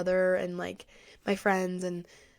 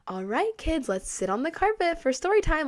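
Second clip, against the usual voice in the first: put-on higher, sing-song teacher addressing little kids; elementary teacher voice; 8 semitones higher than usual. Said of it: "with a weird ac-, like, not an accent".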